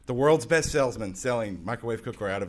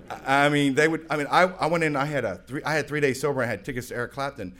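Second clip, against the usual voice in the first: ordinary delivery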